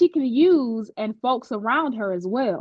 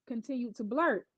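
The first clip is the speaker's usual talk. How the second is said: ordinary delivery, nothing marked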